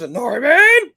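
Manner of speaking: a loud, high furious yell of the name; angry shout. Retold or imitated. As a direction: storm in and yell his name. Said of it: "he storms into us".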